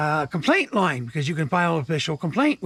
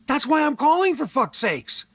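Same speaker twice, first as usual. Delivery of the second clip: retold angry outburst; 8 semitones higher than usual. Caller venting at the bank agent, raised and angry. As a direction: voice jumps up high in exasperation.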